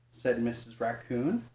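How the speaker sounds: usual speaking voice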